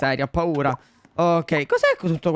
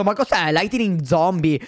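ordinary talk, nothing marked

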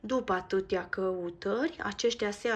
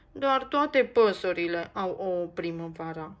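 a mildly louder whiny complaint; a little bird's lament; complaining; 6 dB louder than usual. Acted. Max complains to Nico about his empty nest, mildly whiny. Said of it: "se plânge Max".

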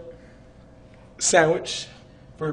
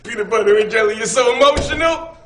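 loud teasing; 7 semitones higher, 11 dB louder than usual. Acted. Teasing emotional Dub.

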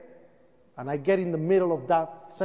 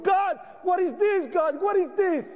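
anguished cry to God; 12 semitones higher than usual. Retold or imitated. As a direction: cry out at the sight of the bodies. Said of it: "I started crying".

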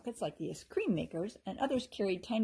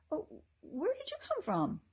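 high, surprised elf voice; a startled little elf asking; lightly voiced surprised elf; 11 semitones higher than usual. A narrator's reading. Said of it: "She looked very surprised".

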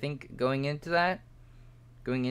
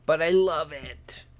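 higher, louder, slow gush; gushing delight; 6 semitones higher, 8 dB louder, slower than usual. Real, not acted. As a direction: swoon over it. Gushing about gross model.

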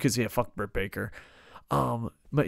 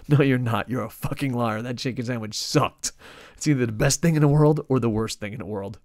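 loud, laughing mock fury at the co-host; hammed-up indignant yelling at a friend; mock outrage; 8 dB louder than usual. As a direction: bellow the accusation half in jest. Mock outrage at cohost's answer.

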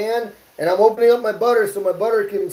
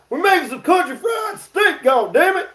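mock shout; 10 semitones higher than usual. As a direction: bellow it like a fake tantrum. Fake outburst, then "just playing.

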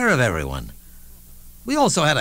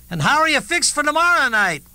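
high, far-off call from the back of the room; a heckler shouting from the back; heckler's shout; 13 semitones higher than usual. Retold or imitated. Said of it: "a voice at the back shouted".

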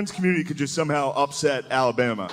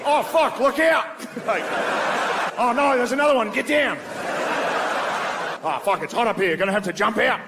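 put-on Australian accent; 10 semitones higher than usual. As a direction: yell it like a panicked Australian in the office. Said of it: "Australian accent's one of those accents".

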